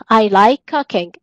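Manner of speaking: careful teacher modelling; slow it down for the class; drawn-out classroom example; slow model sentence. Shown as a demonstration. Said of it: "teacher models English sentence".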